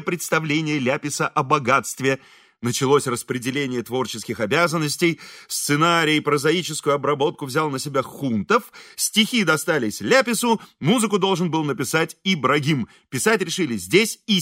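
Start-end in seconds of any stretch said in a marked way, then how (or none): none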